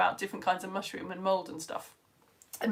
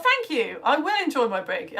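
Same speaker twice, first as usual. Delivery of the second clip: normal talking voice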